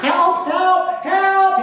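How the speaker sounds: high-pitched frantic cry, voice way up; panicked scream for help. A narrator's reading. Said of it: "he screamed".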